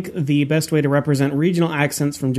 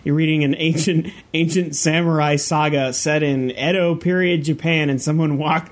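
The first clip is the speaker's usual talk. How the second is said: ordinary talk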